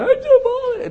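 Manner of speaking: very high, loud, strained wail; wailing crier. Retold or imitated. Preacher imitating someone wailing through tears. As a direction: whine it like a sobbing kid.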